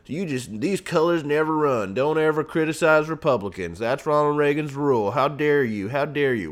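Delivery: hammed-up offended Republican; mock indignant Republican. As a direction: sneer it back as a self-righteous party man. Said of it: "those Republicans were like".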